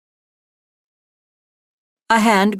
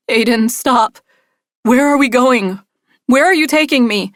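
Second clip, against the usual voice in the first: higher, louder desperate demand; frightened plea; 5 semitones higher, 4 dB louder than usual. A narrator's reading. Woman begging her kidnapper to stop, frightened. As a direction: plead with him in rising panic. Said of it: "I ask again".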